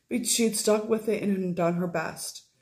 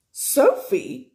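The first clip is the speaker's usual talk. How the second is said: high, loud cry of her name; surprised exclamation; 7 semitones higher, 6 dB louder than usual. Acted. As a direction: call out her name in shock. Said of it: "she exclaimed".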